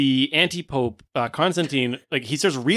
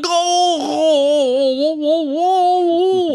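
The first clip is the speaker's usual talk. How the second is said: very high, loud drawn-out howl; hammed-up screaming wail; Santa's wail; 7 dB louder, much slower than usual. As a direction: howl in long waves of agony. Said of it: "And Santa's going".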